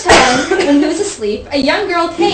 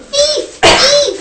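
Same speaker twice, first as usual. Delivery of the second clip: high-pitched cry of "thief"; squealed cry for help; mock alarm shout; 12 semitones higher, 6 dB louder than usual. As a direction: raise a hammed-up alarm, very high. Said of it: "this time he shouted".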